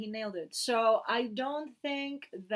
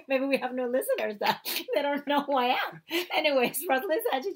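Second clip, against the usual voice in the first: higher, louder, talking through laughter; laughing; 6 semitones higher, 5 dB louder than usual. Real, not acted. Actress teased by husband about introducing herself, laughing. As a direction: laugh through the words as you defend yourself.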